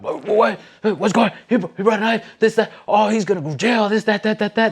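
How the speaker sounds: mock fired-up Homeland Security agent. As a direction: work yourself up over the knife. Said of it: "like, fired up".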